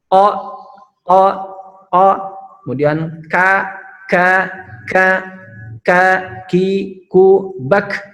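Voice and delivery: loud, pressed "ka, ki, ku"; pressed kaf. Shown as a demonstration. Teacher drilling the pressed kaf for students. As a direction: press each "ka" hard. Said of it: "Jadi harus ditekan".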